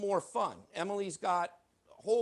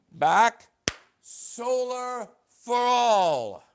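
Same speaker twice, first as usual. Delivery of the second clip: loud showy magic call; magic word shout; 3 semitones higher, 10 dB louder, much slower than usual. Pretend. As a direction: proclaim it like a stage magician. Magician calling magic words.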